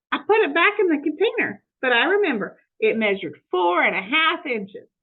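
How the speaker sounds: eager, innocent housekeeper talking to herself; Amelia Bedelia voice. Acted.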